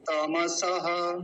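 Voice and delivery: तमसह slowly as ta-ma-sa-ha; full ma. Shown as a demonstration. Teacher repeating the correct form, slow full-vowel demo. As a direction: stretch तमसह, each syllable whole. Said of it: "तम ऐसा बोलेंगे".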